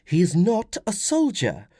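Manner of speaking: high, slow, firm woman's voice. Acted. Wife contradicts husband about son, firmly. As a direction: put your foot down as the mother. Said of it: "Flavia said firmly".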